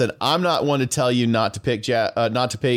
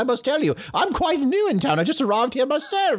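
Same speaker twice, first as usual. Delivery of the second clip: higher, put-on voice of an obliging newcomer; chatty newcomer townsperson; 14 semitones higher than usual. Newcomer answers a request for directions, high talkative townsperson voice. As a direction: pitch it up as a talkative stranger.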